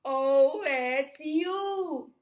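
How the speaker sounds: loud, high, swooping reveal; big storybook exclamation; amused exclamation. A narrator's reading. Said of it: "they laughed and said".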